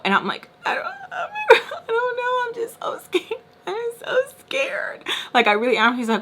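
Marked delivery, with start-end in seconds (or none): 0.6-5.0 hammed-up high, tearful squeak; freaking out. Girl admitting to him she is scared, mock-crying. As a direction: whimper it in an exaggerated scared cry. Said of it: "why are you freaking out".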